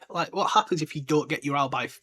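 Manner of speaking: ordinary talk, nothing marked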